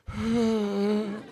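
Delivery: big mock inhale, as before a didgeridoo; breathy gasp. Roommate greeting in a big mock breathy gasp. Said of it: "breathy, like he's about to play a didgeridoo".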